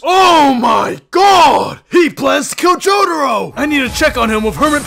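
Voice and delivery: high, loud, alarmed yell; high panicked alarm; panicked shout. Acted. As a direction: panic at the sudden thought. Panicked realisation.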